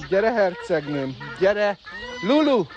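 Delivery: coaxing call rising to a high shout; building call. Real, not acted. Calling bird building to shout. (hívogatás, ami kiáltásba csap)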